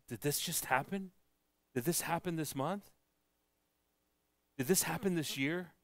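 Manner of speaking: teary amazement. Retold or imitated. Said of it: "a little teary-eyed and says".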